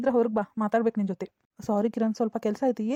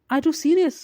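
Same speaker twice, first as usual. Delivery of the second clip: higher and louder, incredulous; stunned disbelief; 5 semitones higher, 5 dB louder, much slower than usual. Acted. Rejected suitor hearing she loves another, incredulous. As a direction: blurt it out, thrown off. Man stunned by her confession.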